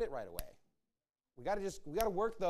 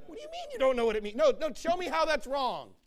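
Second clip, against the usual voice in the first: louder, higher, fast, pushy imitated arguer; mock indignant challenge; 7 semitones higher, 6 dB louder than usual. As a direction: play the smug opponent demanding proof, fast and loud. Imitated opponent arguing back.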